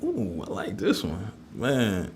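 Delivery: ordinary delivery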